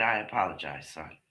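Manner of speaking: husband apologizing to his son in a low voice; imitating dad. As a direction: drop low and quiet like the husband backing off. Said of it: "And his father said".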